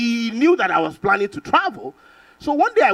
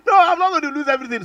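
exasperated laughing protest; 9 semitones higher, 4 dB louder than usual. Retold or imitated. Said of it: "I'm like".

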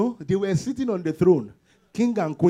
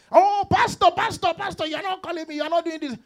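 whiny congregant; 9 semitones higher than usual. Retold or imitated. Congregant complaining to pastor.

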